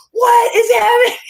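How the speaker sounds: high, loud startled shout; imitating a boss's shocked yell. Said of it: "He'd walk in and go".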